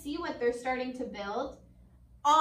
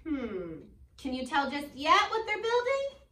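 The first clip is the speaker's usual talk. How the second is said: ordinary delivery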